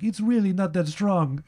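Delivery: high-pitched mock Italian accent; exaggerated Italian old man's voice. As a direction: protest in a put-on Italian accent. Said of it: "make fun of your Italian accent".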